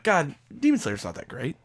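soft whispered dismissal; hushed grudging aside. Retold or imitated. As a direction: whisper it like an unpopular confession. Retelling his later grudging opinion.

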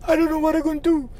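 high, fast tearful wail; imitating a crying client; mock crying. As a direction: blurt it out as if sobbing. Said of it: "starts crying".

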